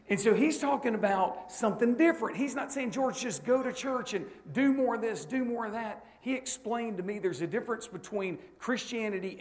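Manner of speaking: loud, high, driving sermon delivery; preaching at full force. Acted. Preacher to young men, full-force fiery preaching. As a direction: preach it hard to the congregation.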